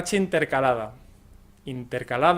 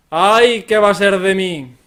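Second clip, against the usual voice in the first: dramatic lament; 2 semitones higher, 10 dB louder than usual. Shown as a demonstration. Novel protagonist mourning a lost love, melodramatic lament. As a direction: wail it like a heartbroken novel heroine. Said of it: "indica pena o temor".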